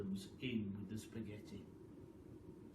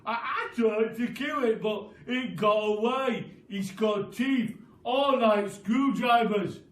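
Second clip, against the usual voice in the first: loud put-on voice teasing his wife; sly Mister Twit; 8 semitones higher, 12 dB louder than usual. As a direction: spin the scary tale with relish. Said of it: "Mister Twit scares wife".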